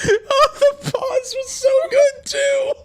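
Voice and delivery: squealing with laughter, slowly; high wheezing laugh. Real, not acted. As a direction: laugh out the words. Howling at the joke.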